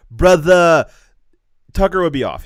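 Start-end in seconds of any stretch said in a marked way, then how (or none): 0.1-0.9 louder mock Jamaican-tinged "brother"; a hint of a slang "brother"; mock slang accent.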